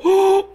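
loud, high gasp; mock shocked gasp. Pastor plays heathens hearing of the church's sin, shocked gasp. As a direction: gasp as if scandalized.

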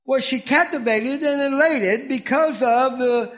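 ordinary delivery

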